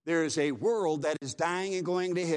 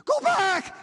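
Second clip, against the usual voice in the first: desperate scream, much higher and louder; screamed warning; 6 dB louder than usual. A narrator's reading. Drowning teen screams to warn his friend, desperate shriek. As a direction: shriek it like your life depends on it. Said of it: "and he screamed".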